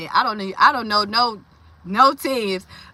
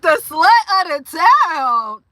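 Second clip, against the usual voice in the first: slow, high disbelieving repeat; incredulous; 4 semitones higher, 6 dB louder, much slower than usual. Retold or imitated. Repeating the comedian's line back to her viewers in slow, stunned disbelief. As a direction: drag it out in disbelief. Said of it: "I was like".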